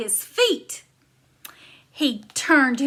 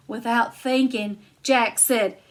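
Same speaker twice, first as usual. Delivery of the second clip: ordinary delivery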